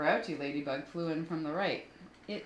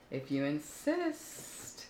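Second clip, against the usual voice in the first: slow snake reply; much slower than usual. Acted. Snake slowly accepting.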